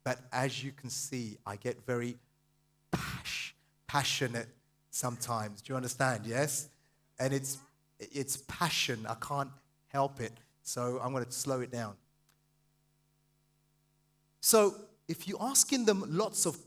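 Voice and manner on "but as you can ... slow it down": lower, softer, measured aside; calm, hushed, low-pitched admission; deliberately slowing down. Real, not acted. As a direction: rein the energy in and explain. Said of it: "do my best to slow it down".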